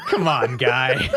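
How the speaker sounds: high burst of laughter, slow "come on, guy" through it; cracking up in disbelief; laughing. Real, not acted. Reacts to barefoot hotel story.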